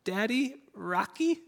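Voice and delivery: put-on high, rising little girl's call for daddy; little daughter calling. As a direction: call out like a sick toddler wanting to be rocked. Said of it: "I can hear her cry through the monitor".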